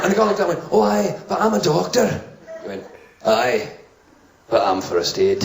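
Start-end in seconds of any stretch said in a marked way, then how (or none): 0.7-2.2 higher Scottish protest; mock indignant Scottish accent; Scottish doctor. Doctor objecting to first aider, indignant Scottish accent. 3.2-5.4 low, slow hard-man voice; tough first aider. Acted. First-aid guy taking over, low tough-guy voice. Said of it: "toughest first aid guy I've ever seen".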